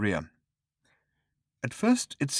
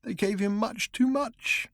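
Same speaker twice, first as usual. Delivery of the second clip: drugged man, despairing; 12 semitones higher than usual. Acted. Drugged man repeats, slower.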